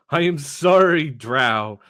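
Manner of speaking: a game narrator formally delivering the bad news; mock game announcer. The game solemnly announcing the race's downfall, in a mock announcer voice.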